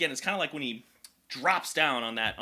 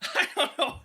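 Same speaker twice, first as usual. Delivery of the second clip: real laughing; 12 semitones higher than usual. Real, not acted.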